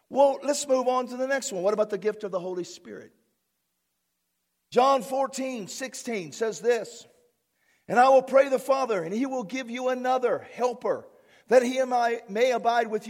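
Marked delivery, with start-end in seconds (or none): none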